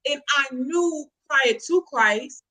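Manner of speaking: ordinary talk, nothing marked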